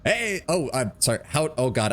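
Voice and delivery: ordinary delivery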